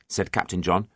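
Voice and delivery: ordinary delivery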